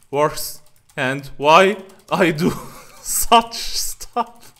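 laughing through words. Real, not acted. Laughing at own mistake while reading.

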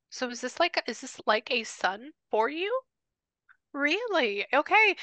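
curious questioning. Real, not acted. Medium questions spirit, voice rises.

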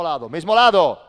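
loud, sharp shout across the court; shouted instruction. Real, not acted. Coach yelling at his players on court, a loud shout.

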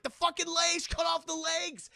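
high, urgent mock yell; imitating a frantic squad leader; shouted battle order. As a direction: yell orders over a fight. Acting character yelling battle orders.

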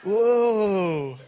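long swooping whoa; mock horse whisperer. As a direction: imitate someone calming a horse, drawing it out. Acting out partner calming the horses.

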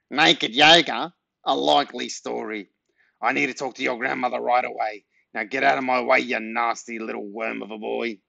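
scornful character voice through the nose; nasal nosy neighbour. A narrator's reading. Unconvinced neighbour shoves boy aside, nasal scornful voice. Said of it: "had a very nasal voice".

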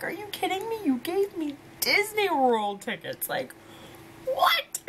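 high-pitched retold disbelief, leaping to a squeal; squeaky, astonished reenactment; incredulous outburst. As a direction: build to a very high squealed WHAT. Retold disbelief at Disney tickets.

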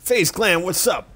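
high, loud streamer shout-out; hype greeting. Pretend. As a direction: yell it like a hype YouTuber.

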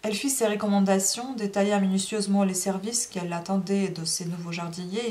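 plain reading voice

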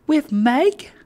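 put-on high-pitched child's question; child's cry. A narrator's reading. Child asking about Meg, high childish voice. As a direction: pipe it up high, rising at the end. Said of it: "cried the child".

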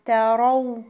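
"تروا" with thin ت and full, dark ر; light "ta", then a heavy "raw" in "تروا". Shown as a demonstration. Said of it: "والراء هتبقى مفخمة".

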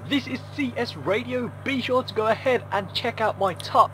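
muffled AM radio sound; radio effect. Shown as a demonstration. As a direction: make it sound like it's on the radio. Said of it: "it's given us a muffle effect already".

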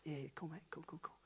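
soft, breathy dismissive whisper. Retold or imitated. Dad hushing his son in church pew, quick whisper. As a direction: brush him off quietly so nobody hears.

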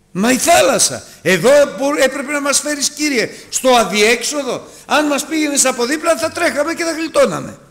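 high-pitched, agitated complaint; acted protest. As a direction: play a panicked objector arguing back. Acting out the people's protest to God.

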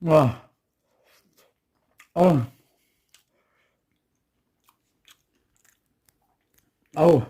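sharp gasps after a scalding bite; burnt mouth gasps. Real, not acted. Tasting hot rice on camera, sharp burnt-mouth gasps. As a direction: gasp and blow through a burning mouth. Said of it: "엄청 뜨겁습니다".

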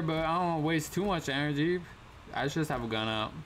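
usual speaking voice

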